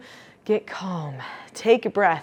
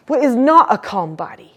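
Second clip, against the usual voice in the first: "What is not a calm body" pushed louder and higher to show upset; louder agitated demo; 4 semitones higher, 7 dB louder than usual. Shown as a demonstration. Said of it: "role play practice those differences".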